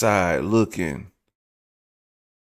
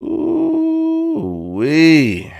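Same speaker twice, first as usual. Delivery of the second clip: amazed ooh wee; 8 semitones higher, 3 dB louder than usual. Real, not acted. Reacting to the left side.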